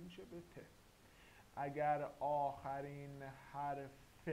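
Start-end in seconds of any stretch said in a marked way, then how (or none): 1.6-4.3 slow teaching emphasis. Shown as a demonstration. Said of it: "teacher stressing the rule".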